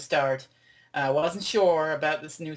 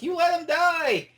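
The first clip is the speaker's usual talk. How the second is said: very high, slow mock-angry accusation; retold mad outburst; 13 semitones higher, 4 dB louder than usual. Fan retelling Journey getting mad at the Doctor, angry. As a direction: imitate her accusing him, pitch way up. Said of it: "Journey gets mad at him".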